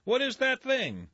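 amazed at the strange craft; astonished. Acted. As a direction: gape at the contraption. Startled townsman.

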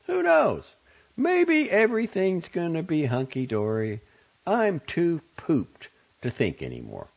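high, emphatic, dismissive woman's voice; matron of honor, fed up. A narrator's reading. Matron of honor too pooped to think, dismissive.

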